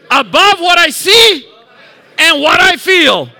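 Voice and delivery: really mean it, full voice; said like you mean it. Shown as a demonstration. Pastor showing the congregation how to mean it, shouting. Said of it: "say it like you mean it".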